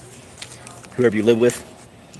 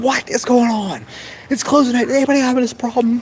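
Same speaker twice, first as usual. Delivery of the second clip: loud panicked coworker. Retold or imitated. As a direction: play the coworker yelling to the whole office, much higher. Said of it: "talking really loud in the office".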